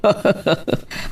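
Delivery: airy, whispered chuckle; breathy laugh. Acted. Father reacting to the nephew plan, airy chuckle. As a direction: let out a soft breathy laugh.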